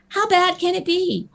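high, breezy rhetorical question; retold naive confidence. Recalling why she agreed to bungee jump, blithely confident. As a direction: shrug it off, pitched high. Retells her naive reasoning before jumping.

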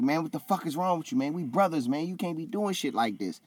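high-pitched retold remonstration; Roderick Strong, upset. Retold pleading rebuke between brothers.

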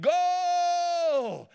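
a huge high yell, full voice; triumphant shout. Retold or imitated. Schoolboy in the goal bellows his triumph, a big high shout. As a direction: roar it like you just scored. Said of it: "he shouted".

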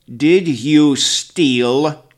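very slow, loud judging question; terrible elder voice. A narrator's reading. Grandfather interrogating the thief, slow and terrible. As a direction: drag it out like a threat. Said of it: "voice is terrible to hear".

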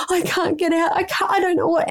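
put-on high, frightened small child pleading; distressed little boy. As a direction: voice a scared toddler stuck in a hot car. Stressed child locked in car.

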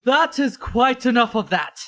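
the captain stops the brawl cold; stern commanding voice. Acted. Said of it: "A stern voice rings out, loud without requiring shouting and filled with authority".

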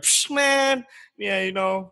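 high, loud swaggering "psh, man"; brothers' accent change imitated; street slang voice. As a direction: switch into the brothers' macho talk. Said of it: "speaking like this and to, like".

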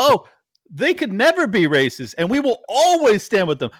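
high, loud sarcastic parroting; mock the politicians' pledge, loud and high; mocking quote. Host mocking a congressman's pledge: high, loud sarcasm. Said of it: "And then to say".

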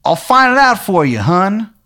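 put-on high, terrible Maryland accent; Shithead Steve's Maryland accent. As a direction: proclaim it in a hammed-up Maryland twang. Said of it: "he's got, like, a terrible Maryland accent".

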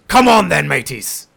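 rally the crew; captain calls to his crew, hearty. Acted. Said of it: "and says".

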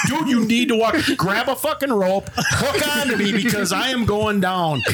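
high laughing plea. Retold or imitated. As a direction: beg for help through laughter. Retelling his plea, laughing.

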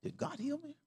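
high but hushed doubtful question. Retold or imitated. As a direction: ask it softly, half to yourself. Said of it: "Then I'm saying".

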